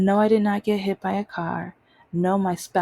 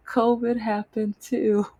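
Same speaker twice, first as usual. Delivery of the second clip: oh right, laugh at yourself; laughing realization; 5 semitones higher than usual. Retold or imitated. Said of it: "I'm like".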